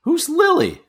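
very high sudden question; sharp question. Acted. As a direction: fire it back in disbelief. Suspicious soldier questions stranger.

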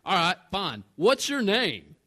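loud, pointed retold demand; huffy, confrontational comeback; upset. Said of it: "so I'm a little upset".

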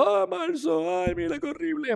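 mock crying wail. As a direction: whine about March like a panicked crybaby. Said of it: "Y por aquí estábamos llorando".

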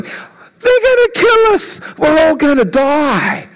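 high, panicked cry; panicking servant. Retold or imitated. Servant panicking at the sight of the Syrian army, high frightened cry. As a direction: squeal it out like a terrified servant. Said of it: "he's panicking. He's trembling".

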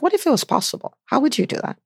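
retold coaching question, pitched well up; encouraging challenge. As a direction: nudge her to dream bigger, lifting high.

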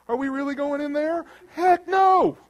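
high, loud, panicky refusal at the valley's edge; mock fearful balk. Believer balking at going back through the valley, mock terrified. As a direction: whine like you won't go in there. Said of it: "you're like".

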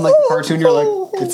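very high squeaky cartoon voice; squeaky mock voice. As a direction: jump way up into a squeak. Mocking the pervy voice.

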